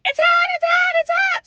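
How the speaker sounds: put-on high, loud whiny chorus of kids; mock kids' yelling. Kids in the car complaining to mom, high mock yelling. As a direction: yell it like complaining children, very high. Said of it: "Everybody starts yelling".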